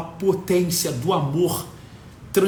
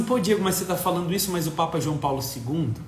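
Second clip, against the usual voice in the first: softer, quick objecting voice; quiet objector; 4 semitones lower, 5 dB quieter, faster than usual. Retold or imitated. A critic objects to Diego, quick and soft. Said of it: "fala assim".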